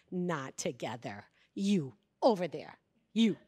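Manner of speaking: low-voiced command in short bursts; stern orders. Retreat leader separating two boys, stern orders. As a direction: firmly separate two troublemakers.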